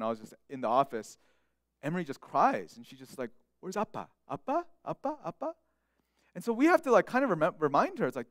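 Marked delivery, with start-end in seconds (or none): 3.6-5.5 high, softer whimpering call for Appa; a little girl pleading for her dad; toddler calling for dad. Retold or imitated.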